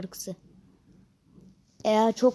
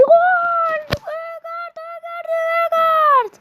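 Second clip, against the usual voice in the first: much higher, louder goal scream; screaming football commentator; 12 semitones higher, 4 dB louder than usual. Pretend. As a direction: scream the name like a stadium commentator. Commentating a long-range goal.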